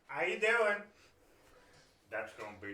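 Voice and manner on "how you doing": high, loud cheerful greeting; chirpy high hello; Gibbons. Acted. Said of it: "And Gibbons like".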